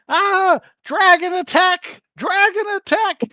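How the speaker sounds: very high, shrill squawking panic with slow, stretched words; a frantic mother duck shrieking about a dragon; panicked duck. Acted. Duck mother screaming to the woods in high panic. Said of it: "and the duck's like".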